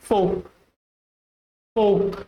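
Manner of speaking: "folk" as FOKE, no L; silent L. Shown as a demonstration. Teacher gives correct pronunciation, L silent. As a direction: say "folk" to rhyme with oak. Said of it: "So the correct pronunciation is".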